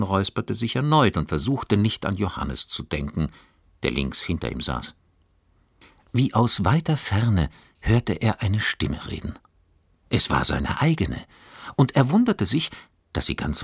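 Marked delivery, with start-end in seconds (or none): none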